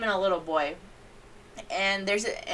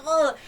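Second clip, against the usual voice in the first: disgusted ugh; 8 semitones higher, 5 dB louder than usual. Retold or imitated. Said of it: "I'm just like".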